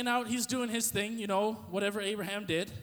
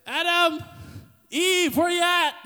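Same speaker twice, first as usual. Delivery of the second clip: high, loud call across the garden; yelling out; 8 semitones higher, 7 dB louder, slower than usual. Retold or imitated. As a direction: call out for them from far away. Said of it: "and he yells out".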